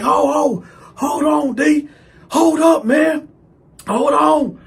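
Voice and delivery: high, loud mock pleading; imitating a cellmate's alarmed protest; panicked pleading. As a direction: plead for the blows to stop. Retold cellmate begging while hit.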